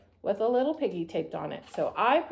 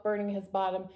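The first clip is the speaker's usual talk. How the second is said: ordinary delivery, nothing marked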